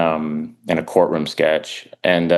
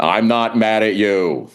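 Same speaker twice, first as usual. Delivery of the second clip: Elliot Spitzer; 3 semitones higher, slower than usual. Retold or imitated. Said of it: "he immediately went".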